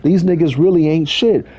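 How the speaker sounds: a passionate hype-up, voice lifted high; passionate pep talk. Retold or imitated. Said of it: "I felt passionate".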